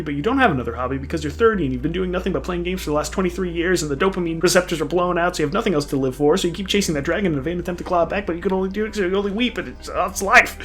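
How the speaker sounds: usual speaking voice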